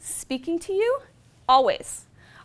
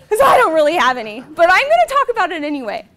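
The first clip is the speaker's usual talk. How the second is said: laughing; 3 semitones higher, 11 dB louder, faster than usual. Real, not acted. Laughing about having no patience.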